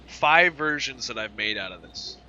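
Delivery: projecting over a roaring engine; talking over loud engines. Real, not acted. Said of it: "You probably can't even hear me right now. These engines are loud".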